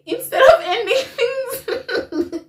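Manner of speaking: slow, high words through real laughter; laughing self-mockery. Real, not acted. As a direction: laugh at your own foolishness. Laughing at own choice.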